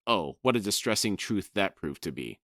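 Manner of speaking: ordinary talk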